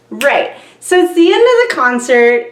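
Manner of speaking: ordinary talk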